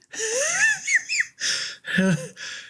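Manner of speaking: high, breathy wheeze of laughter; long helpless wheezy laugh; wheezing laugh. Real, not acted. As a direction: crack up at your own story. Laughs at own story.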